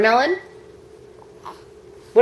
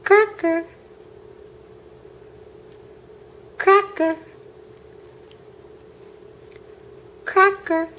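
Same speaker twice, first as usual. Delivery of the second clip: much higher, sing-song cracker; mother repeating the word for her baby, high chirp; high model word; 10 semitones higher, 5 dB quieter, slower than usual. Acted.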